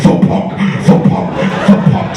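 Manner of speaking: voiceless, punchy rhythmic thumps; imitated thumping noise. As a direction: puff out each thump as a sound effect. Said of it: "you can hear 'em like".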